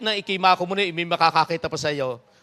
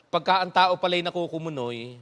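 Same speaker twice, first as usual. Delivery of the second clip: normal talking voice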